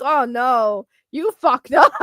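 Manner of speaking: loud, breaking up on "up"; laughing verdict. Real, not acted. Streamer reacting to a viewer's ex story, laughing. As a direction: crack up as it lands.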